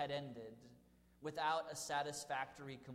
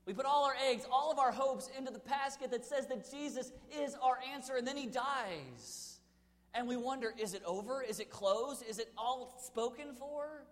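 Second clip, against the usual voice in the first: higher, louder preaching build; impassioned preaching; 7 semitones higher, 5 dB louder than usual. Acted. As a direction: drive it home from the pulpit. Preaching at full force.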